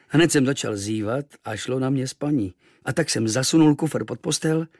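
usual speaking voice

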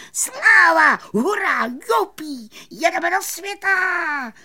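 very high, loud squealed cheers; enthusiastic shrieking. A narrator's reading. Friends cheering as they set off, high excited shrieking. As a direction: shriek it with joy. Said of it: "vřískali nadšeně".

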